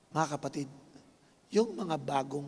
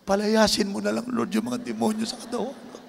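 crying prayer; 7 semitones higher than usual. Retold or imitated. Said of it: "Wala akong nagawa kundi umiyak".

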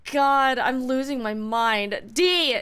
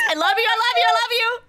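yelled, higher and a bit louder; screaming love; 9 semitones higher, 4 dB louder, much faster than usual. Real, not acted. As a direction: scream your thanks at a friend. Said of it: "I gotta scream at Sai Lubbers".

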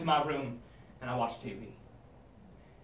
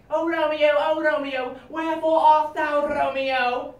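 high swooning Juliet; melodramatic Juliet; 10 semitones higher, 7 dB louder, slower than usual. Acted. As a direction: swoon it like a stage heroine. Shakespeare parody.

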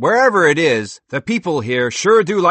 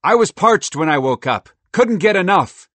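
loud, high delighted squirrel; a thrilled squirrel marvelling; 8 semitones higher, 11 dB louder than usual. Acted. Merle delighted.

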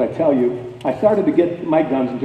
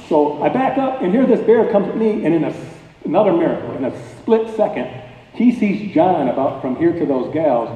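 ordinary talk, nothing marked

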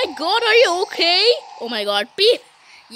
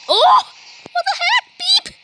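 high, loud cry of surprise; startled yelp; 13 semitones higher, 4 dB louder than usual. Real, not acted. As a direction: yelp at the sudden shock. Sudden surprise in the game.

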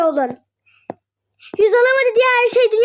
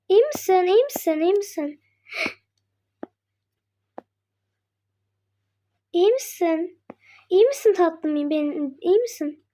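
tender concern; 5 semitones lower, 5 dB quieter than usual. Acted. To the shaken friend, softly asking if she's okay. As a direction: gently ask if she's okay.

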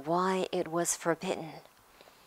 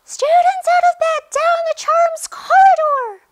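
very high, screeching yell; bellowing; 16 dB louder than usual. A narrator's reading. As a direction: screech the alarm down the hallway. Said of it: "Peeves bellowed".

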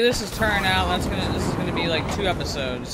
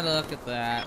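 lower, softer remark to himself; low mutter; 7 semitones lower, 7 dB quieter than usual. Real, not acted. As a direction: say it low and under your breath. Repeats the remark, first take low.

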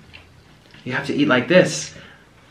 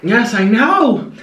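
excited slow exclaim; 6 semitones higher, 4 dB louder, much slower than usual. Real, not acted. Excited agreement.

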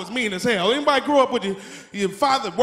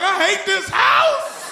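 higher, slow drawn-out whine; whiny kid; 7 semitones higher, much slower than usual. Retold or imitated. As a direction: stretch out the complaint. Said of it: "You're like".

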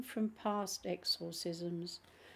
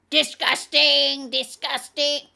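very loud, drawn-out guttural outburst; guttural demon rasp; 7 semitones higher, 15 dB louder than usual. A narrator's reading. Demon revolted by the greeting saints, loud guttural rasp. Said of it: "back to a deep guttural grasp".

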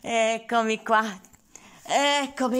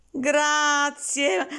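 normal talking voice

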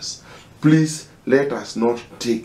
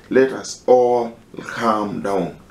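ordinary delivery

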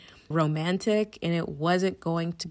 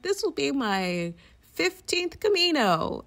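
lightly voiced older woman, higher; a hint of a proud old pilgrim; elderly woman; 9 semitones higher than usual. Quoting the forum's old woman, lightly voiced and proud. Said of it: "who's like".

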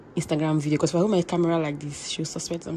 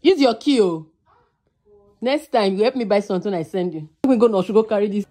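calling out; 2 semitones higher, 6 dB louder than usual. Real, not acted. Vlogger calling to her friend across the house, loud. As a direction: call out to someone in another room.

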